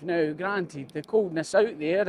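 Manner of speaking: ordinary talk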